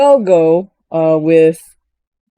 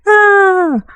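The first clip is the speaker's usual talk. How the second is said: mock fangirl scream; 12 semitones higher than usual. Fan seeing the boyfriend on screen, mock screaming. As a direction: squeal in put-on fan delight. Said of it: "I am also screaming".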